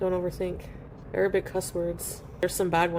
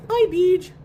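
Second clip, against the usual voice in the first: high, loud hello to a cat; high cat greeting; pet greeting; 10 semitones higher, 7 dB louder than usual. Real, not acted.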